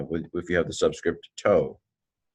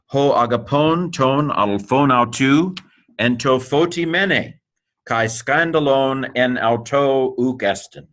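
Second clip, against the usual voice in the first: slow, higher, measured reading aloud; Greek verse recitation; 4 semitones higher, slower than usual. Shown as a demonstration. Teacher reading the whole Greek verse to students, slow recitation. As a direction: recite it word by word, slowly and raised in pitch.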